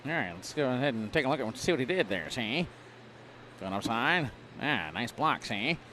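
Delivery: lightly voiced 1930s gangster commentary; play-by-play like a mild old-time mobster, ending lines with see; a hint of a Mafia wiseguy calling the game. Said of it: "do your play-by-play for this round".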